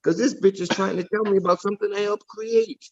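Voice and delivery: high-pitched, exasperated rant; heated, incredulous complaint; indignant. Real, not acted. Real argument over who created it.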